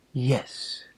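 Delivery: soft breathless whisper; a hushed yes, barely voiced. Mother answers Mrs Hasselbeck, in a whisper.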